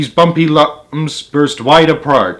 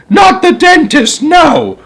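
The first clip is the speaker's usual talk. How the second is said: very high, panicked shout; high-pitched child's shouted protest; 4 dB louder, slower than usual. Acted. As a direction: cry out in horrified refusal. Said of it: "I shouted".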